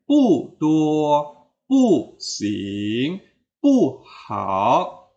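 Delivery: "pu tuo, pu sing, pu hao", each "pu" falling; unchanged fourth-tone pu. Shown as a demonstration. Teacher recapping examples, falling "pu" in each pair. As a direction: drop every "pu", drawing out the second syllable. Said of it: "pu ini akan tetap dibaca pu".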